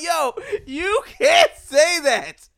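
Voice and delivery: high, loud, drawn-out objection; appalled protest. Retold or imitated. Storyteller telling his friend off, appalled. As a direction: hammer it slowly in replayed disbelief.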